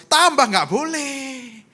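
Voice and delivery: loud, high, drawn-out objection; emphatic refusal. Retold or imitated. Husband recalling his allergic wife's forbidden food, emphatic. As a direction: exclaim it, stretching the words out.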